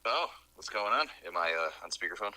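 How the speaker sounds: ordinary delivery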